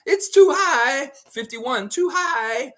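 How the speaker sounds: shouted high and loud, drawn out; sing-song shout of too high; loud high call. Real, not acted. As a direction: yell it's too high at chat. Repeating too high playfully.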